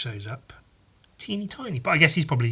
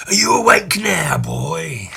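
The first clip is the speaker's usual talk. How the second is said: put-on gruff old man; 4 semitones higher, 4 dB louder than usual. Reading comic, voicing old man.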